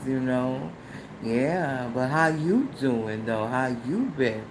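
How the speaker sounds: low, soft, smooth creepy imitation; imitating his tone. Imitating the older man's smooth voice message. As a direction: mimic a smooth-talking older man. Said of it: "That exact fucking tone".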